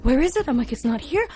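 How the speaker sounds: high panicked rush; frantic. Retold or imitated. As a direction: sound frantic searching. Frantic search retold.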